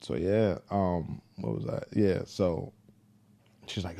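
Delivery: ordinary talk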